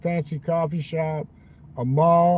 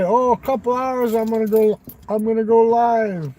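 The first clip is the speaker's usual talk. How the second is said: higher, louder mock of a friend's excuse; higher, louder whiny imitation of the friend; mocking Will; 7 semitones higher, 7 dB louder than usual. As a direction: parrot him in a whiny put-on voice. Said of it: "I thought he would say".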